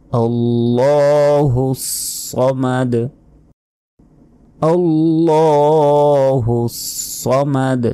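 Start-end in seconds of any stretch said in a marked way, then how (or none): 0.1-1.8 আল্লাহুস slow with the s held; drawn-out s. Shown as a demonstration. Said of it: "আল্লাহু নয়, আল্লাহুস". 4.6-6.7 আল্লাহুস slow with the s held; drawn-out s. Shown as a demonstration. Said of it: "আল্লাহু নয়, আল্লাহুস".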